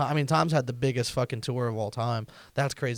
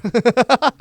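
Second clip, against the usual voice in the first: big burst of laughter; cracking up loudly; 11 semitones higher, 13 dB louder than usual. Real, not acted.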